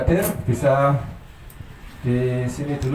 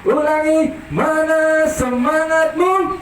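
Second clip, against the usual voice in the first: loud rallying call; 10 semitones higher than usual. Real, not acted. Host pushing kids to be louder, high and sung. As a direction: call the crowd up, then sing it out. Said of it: "harus yang keras suaranya".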